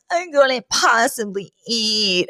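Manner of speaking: mildly hammed-up weepy voice; mock tearful. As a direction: pretend to be upset, dragging out the words. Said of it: "sinks into Mister Sheffield's arms crying".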